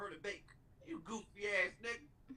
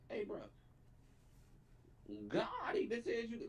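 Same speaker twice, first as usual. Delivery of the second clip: ordinary talk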